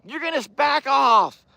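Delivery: high, sassy cow voice speaking for Rita; jealous cow protecting her daddy; cow's voice, possessive and high; voicing the cow. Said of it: "Rita's like".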